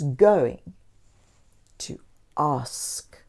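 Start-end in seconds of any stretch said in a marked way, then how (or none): 1.8-3.2 soft whispered secret; a hushed reveal for children. A narrator's reading. Suspense on the reveal.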